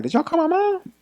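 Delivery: high, startled question; suddenly worried, voice jumps up; imitating his own panic; alarmed.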